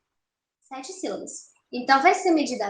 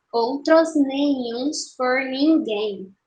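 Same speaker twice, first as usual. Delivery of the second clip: "Outros nenhuns por ninguém" very slow, syllables separated; escansão silábica; 3 dB louder, much slower than usual. Shown as a demonstration. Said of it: "vamos fazer a escansão". ("Outros nenhuns por ninguém" bem devagar, separando as sílabas)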